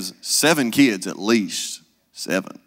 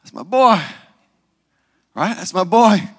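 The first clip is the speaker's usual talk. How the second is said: high loud whoop, then a proud cheer; mock proud mama shouting; proud mother; 8 semitones higher, 6 dB louder than usual. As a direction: cheer for your son. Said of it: "You'd be like".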